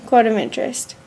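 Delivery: ordinary delivery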